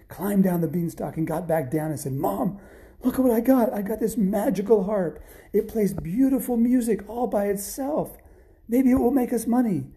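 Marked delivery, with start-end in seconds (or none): 2.1-9.9 high-pitched, breathless-with-news delight; excited boy. Acted. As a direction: burst in to show off a treasure. Jack shows his mother the harp.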